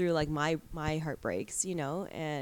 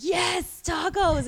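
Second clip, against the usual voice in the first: put-on excited kid; 12 semitones higher, 10 dB louder, much slower than usual. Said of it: "and he's like".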